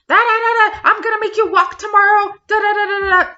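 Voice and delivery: very high, loud mocking babble; mocking her ranting. Mocking the woman losing it over the noise, very high loud babble. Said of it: "She's losing her shit".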